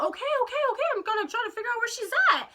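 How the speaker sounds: high, hurried imitation of a friend; flustered placating. Friend to panicking teller about sister, high hurried placating. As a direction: rattle it off high to calm someone down.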